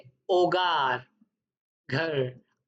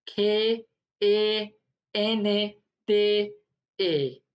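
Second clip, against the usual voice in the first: slow spelling; much slower than usual. Shown as a demonstration. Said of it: "ए आ रहा होगा sound तो".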